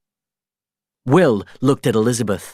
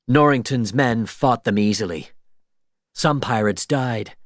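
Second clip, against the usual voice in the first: ordinary delivery, nothing marked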